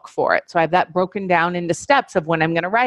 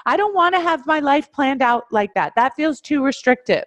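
higher, whiny mock protest; freaked-out objection; 8 semitones higher than usual. Student resisting planning their life, mock whiny and higher. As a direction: act out a student balking at a strict schedule. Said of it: "freak out and be like".

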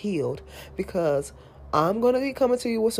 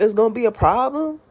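ordinary delivery

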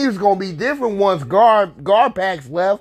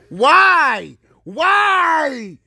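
anguished cry; 7 semitones higher, much slower than usual. Real, not acted. Despairing outburst.

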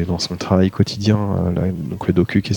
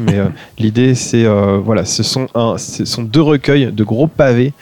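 ordinary delivery

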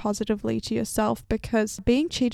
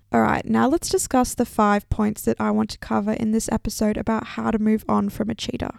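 normal talking voice

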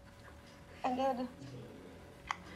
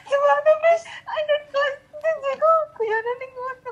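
laughing; 13 semitones higher, slower than usual. Real, not acted. Reacting to a funny story.

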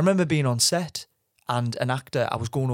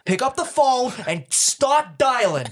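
high, loud, slow hyped-up salesman; slow, booming stockbroker rallying cry; Jordan Belfort style; 11 semitones higher, 4 dB louder, slower than usual. Retold or imitated. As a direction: pump up the cold-caller like a Wall Street boss. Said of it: "proper Jordan Belfort style".

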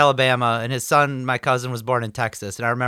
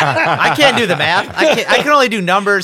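laughing; 7 semitones higher, 4 dB louder than usual. Real, not acted. Joking about own math.

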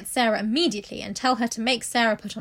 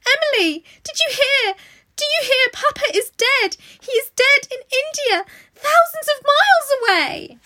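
crying out wildly; 9 dB louder than usual. A narrator's reading. Girl crying to her doll that papa died: wild, high wail. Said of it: "cried out wildly".

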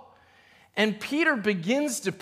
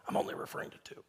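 laughing whispered aside; 13 dB quieter, much faster than usual. Real, not acted. Laughing aside about genders.

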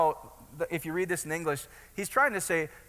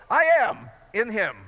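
"I am in him" said louder and slower, each word planted; bold declaration; 3 semitones higher, 6 dB louder, slower than usual. Shown as a demonstration. Preacher showing the congregation how to claim it, bold and loud. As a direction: declare "I am in him" with full conviction. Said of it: "some days I feel more bold in that".

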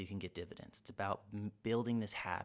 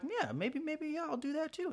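much higher, dithering voice; hesitant Bob. Pretend. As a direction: waffle into agreeing with him. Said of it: "Bob with the coffee cup that's like".

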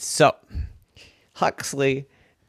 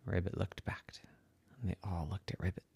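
soft whisper; suspense whisper; 5 semitones lower, 16 dB quieter, faster than usual. Acted. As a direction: hush it for suspense.

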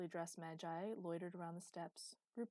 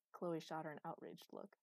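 ordinary delivery

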